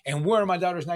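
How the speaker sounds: ordinary delivery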